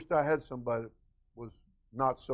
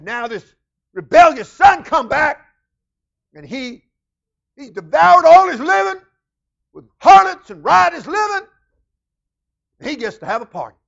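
loud, high resentful complaint; pouting elder brother; 12 semitones higher, 18 dB louder than usual. Acted. Preacher voices the elder brother resenting the prodigal. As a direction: spit out the brother's grudge. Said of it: "this pouting elder brother".

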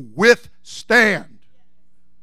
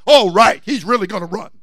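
sarcastic; 5 semitones higher, 12 dB louder than usual. Real, not acted. Mocking the president's rebuke.

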